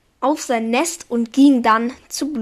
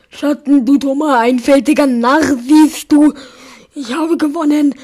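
loud, gloating, full of hate; hateful gloating; 2 semitones higher, 6 dB louder than usual. Acted. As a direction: crow over him with hatred. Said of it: "rief sie voller Hass".

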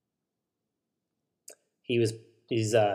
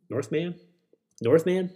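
higher, sing-song rising question; playful high repeats; 6 semitones higher than usual. Pretend. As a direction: toss the word up playfully, higher each time.

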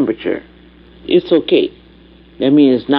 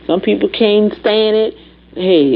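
usual speaking voice

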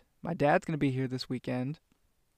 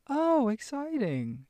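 high, slow, overly cheery reply; Dora voice; 11 semitones higher, slower than usual. Pretend. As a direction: gush slowly like a cartoon host. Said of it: "I feel like Dora".

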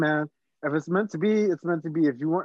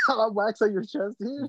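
laughing; 6 semitones higher, slower than usual. Real, not acted. Laughing at cousin's waxing.

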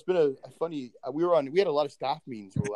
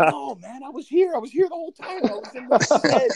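high, loud protest, much higher; 12 semitones higher, 7 dB louder than usual. Retold or imitated. [name] protesting to colleagues who can't hear him, high and loud. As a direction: insist you were there all along, pitch shooting up. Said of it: "I'm like".

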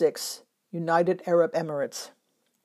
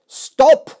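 put-on sudden loud, high cry of "Stop!" in a Russian accent; 7 semitones higher, 14 dB louder than usual. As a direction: shout it out like a Russian boy who is about to be sick. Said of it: "cried out in his Russian accent".

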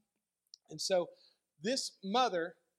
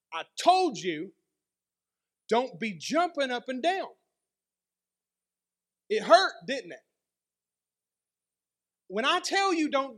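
scolding mother; 5 semitones higher, 6 dB louder than usual. Retold or imitated. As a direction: drag out I told you, then lecture. Mother scolds son after head bump.

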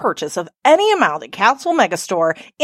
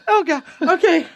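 ordinary talk

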